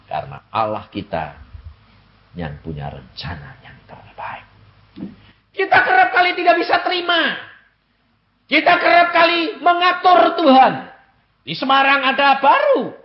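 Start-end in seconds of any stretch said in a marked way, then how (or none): 5.5-12.9 high, loud, fast rebuke from the pulpit; fiery preaching. Acted. Preacher scolding believers who manage God, fast loud preaching. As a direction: hammer the point home.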